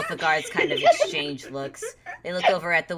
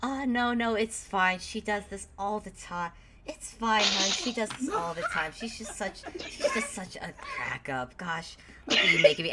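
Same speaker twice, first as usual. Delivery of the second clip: higher, soft, chuckling dismissal; fond reassurance; 3 semitones higher, 4 dB quieter than usual. Acted. Charmed man assures his companion it's fine, fondly amused. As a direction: wave it off with doting amusement.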